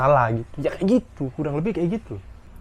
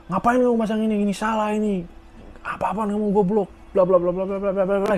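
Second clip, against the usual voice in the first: high scolding rant of a senior boss; angry senior; 7 semitones higher than usual. Retold or imitated. Senior scolding a junior worker, high angry rant.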